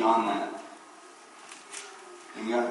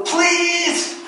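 very high, loud pleading please; child begging dad, high and shrill-free loud whine; whining child; 6 dB louder than usual. Retold or imitated. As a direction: wail it like a begging kid. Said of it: "It's begging".

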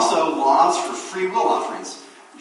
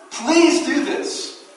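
much higher, very slow desperate plea; leper begging; 12 semitones higher, 5 dB louder, much slower than usual. Retold or imitated. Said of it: "lepers begging".

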